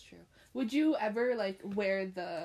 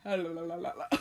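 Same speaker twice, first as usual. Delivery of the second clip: mocking babble; 5 semitones lower, faster than usual. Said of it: "All I heard was".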